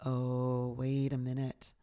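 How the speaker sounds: low, soft inner voice of suspicion; little doubting voice. Acted. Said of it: "this little voice is like".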